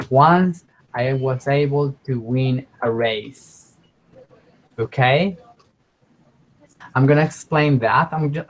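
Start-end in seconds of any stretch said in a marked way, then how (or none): 0.1-3.8 slowly and clearly, for learners; slow model sentence. Shown as a demonstration. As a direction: spell it out like a teacher. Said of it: "teacher models the sentence".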